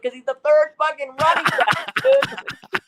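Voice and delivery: high-pitched mock-exasperated answer; retold incredulous retort, climbing very high; imitated exasperation. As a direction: squeal the obvious answer back.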